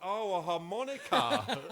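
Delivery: high, loud, slow laughing "Oh, a harmonica"; dawning, through laughter; high laughing realisation. Retold or imitated. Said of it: "I was like".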